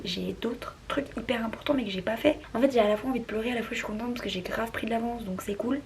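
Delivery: soft late-night whisper. Real, not acted. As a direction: whisper so nobody wakes.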